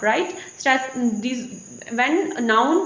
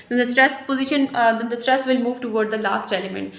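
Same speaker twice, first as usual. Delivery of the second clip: ordinary talk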